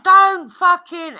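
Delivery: loud, high shout of warning; retold shout. Woman yelling at husband by the switch, loud. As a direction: yell it to stop him.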